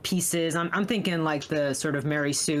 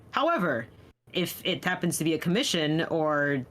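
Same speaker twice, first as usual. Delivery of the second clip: normal talking voice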